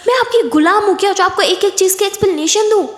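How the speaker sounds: high, heated rant; angry shouting. Acted. Aditi ranting at controlling Kiran, angrily shouting. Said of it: "आप चिल्ला रही हो".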